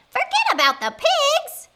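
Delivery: high, loud, disbelieving question; witchy squawk of astonishment; incredulous witch. Acted. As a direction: echo it back in outraged disbelief. Witch incredulously repeats the advice.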